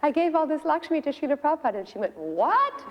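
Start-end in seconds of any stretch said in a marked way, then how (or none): 0.0-1.8 high, eager retelling of the husband; higher-pitched excited boast; enthusiastic. Retold or imitated. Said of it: "he was all enthusiastic". 2.2-2.8 a high, sharp startled what; sudden higher-pitched disbelief; shocked what. Retold or imitated. Wife shocked at the donation.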